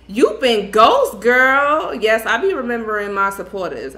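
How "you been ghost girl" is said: loud, slow teasing call to a friend; loud playful call-out. Real, not acted. Teasing a long-absent viewer.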